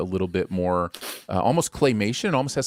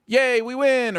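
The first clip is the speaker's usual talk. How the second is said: much higher, sarcastic yay; mock cheer; 3 dB louder, slower than usual. Critic parodying the neat happy ending, mock cheer. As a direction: cheer like a kid at the tidy finale. Parodying the movie's plot beats.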